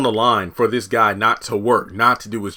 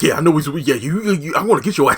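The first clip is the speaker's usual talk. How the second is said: higher, stammering menace; mock threat; 6 semitones higher, 4 dB louder, faster than usual. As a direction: threaten him, tripping over the words. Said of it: "then Donald's like".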